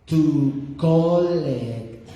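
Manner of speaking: "To collect" stretched out very slowly. Retold or imitated. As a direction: spell it out slowly for a confused caller. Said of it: "slowly, slowly".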